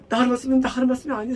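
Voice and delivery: ordinary talk, nothing marked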